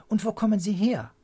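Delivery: high, loud, eager question; inquisitive child. A narrator's reading. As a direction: ask like a curious kid.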